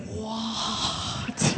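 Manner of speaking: hugely stretched, stunned "what"; Matrix slow motion. Retold or imitated. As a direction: drag the word out as if time slows. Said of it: "it was like the Matrix".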